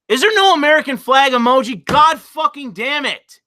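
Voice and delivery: high, loud exasperated outburst. Real, not acted. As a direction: curse the missing emoji in real frustration. Missing emoji spoils his joke.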